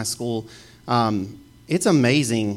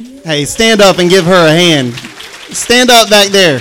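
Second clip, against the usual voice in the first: loud call across the room; 7 semitones higher, 11 dB louder than usual. Real, not acted. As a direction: shout to the back. Calling to couple in back.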